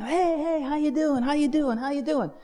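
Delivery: high-pitched sing-out hellos; carnival greetings. Retold or imitated. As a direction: greet passers-by in a high, breezy voice. Greeting people along carnival street.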